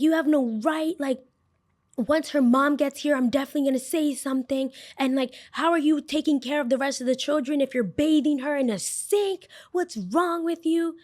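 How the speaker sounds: high-pitched accusing mom voice; imitating the scolding mom. Angry mom accusing the worker of neglect, high-pitched. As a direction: raise the pitch and accuse her. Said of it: "And she's like".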